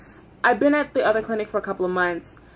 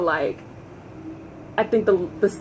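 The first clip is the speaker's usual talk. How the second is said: ordinary talk, nothing marked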